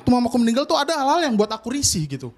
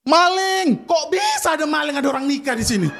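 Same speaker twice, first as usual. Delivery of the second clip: brother's shout; 6 semitones higher than usual. Acted. Brother shouting at father's wedding as witness, alarmed yell. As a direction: yell out, first word hardest. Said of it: "Di situ abangku langsung teriak".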